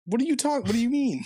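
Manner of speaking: indignant surprise. Real, not acted. Accused of being Chelsea fan.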